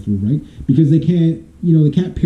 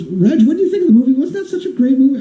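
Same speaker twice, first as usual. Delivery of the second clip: high, quick imitation of a gushing friend; female friend; 9 semitones higher than usual. Female friend asking about the movie, mock high voice. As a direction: pitch it up as an enthusiastic girlfriend. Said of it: "she asked me, she goes".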